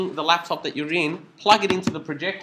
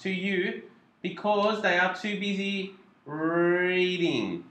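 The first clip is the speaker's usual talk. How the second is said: slow reveal; 2 semitones higher, slower than usual. Shown as a demonstration. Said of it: "That took, like, thirty seconds of pain".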